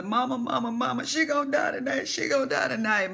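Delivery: high, urgent mock cry to mama; distressed call. Calling mother in distress.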